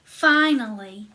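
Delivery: ordinary delivery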